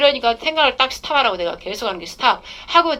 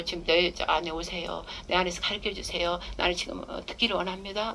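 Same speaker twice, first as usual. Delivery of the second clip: humble prayer; 4 semitones lower, 10 dB quieter than usual. Shown as a demonstration. Preacher showing the flock how to pray, soft and humble. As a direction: softly beg the Spirit to come in. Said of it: "막 이래야 돼".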